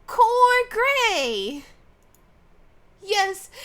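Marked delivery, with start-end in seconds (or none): 0.1-1.6 put-on higher, dramatic magical girl catchphrase; reluctant but committed hero pose line; anime heroine line. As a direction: declaim it like a transformation scene. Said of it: "can you do that thing? The signature line".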